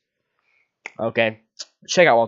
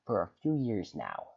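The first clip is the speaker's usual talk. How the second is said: ordinary delivery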